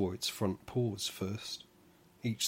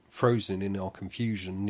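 usual speaking voice

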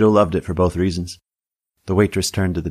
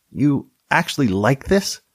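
disgusted Tammy voice; 6 semitones higher, slower than usual. A narrator's reading.

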